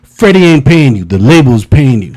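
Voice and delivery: bossy manager rant. Retold or imitated. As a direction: lay down the law, half laughing. Pit bull manager scolding him.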